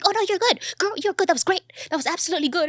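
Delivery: high, loud gushing reassurance from a co-star; co-star reassuring. Retold or imitated. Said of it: "And she was like".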